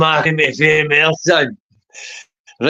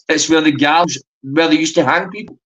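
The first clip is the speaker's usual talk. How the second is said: ordinary talk, nothing marked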